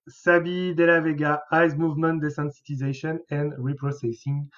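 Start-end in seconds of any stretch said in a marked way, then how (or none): 1.5-4.5 softly spoken English with a French accent. Real, not acted. Therapist reading the winning answer to webinar viewers, French-accented English. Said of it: "pardonnerez pour mon accent anglais".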